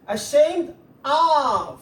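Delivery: slow, with "of" drawn out long; stress on "of". Shown as a demonstration. Teacher correcting students' preposition, long stressed "of". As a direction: stretch the word "of" to make it stand out.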